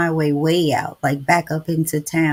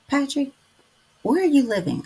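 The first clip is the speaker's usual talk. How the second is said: raised question; 9 semitones higher than usual. Real, not acted. Asking viewer Patrick where he lives, raised high. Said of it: "let me ask that".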